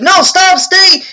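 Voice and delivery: high, loud, separate shouts; shouted commands. Retold or imitated. George trying to halt the dog, high shouted commands. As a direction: imitate a frantic owner ordering the dog.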